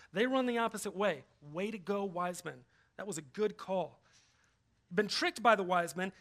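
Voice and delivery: usual speaking voice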